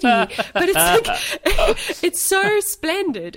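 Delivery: high, laughing through words. Real, not acted. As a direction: gush while laughing. Laughing at the video.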